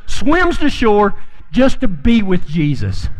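high, lively, energetic retelling; preacher swept up in the story; excited storytelling. Acted. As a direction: tell it like you can barely contain it. Excited retelling of Peter jumping.

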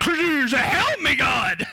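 high, hammed-up cry for help; mock panicked cry. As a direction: act out a sinking man's yelp. Pete sinking, cries out for help.

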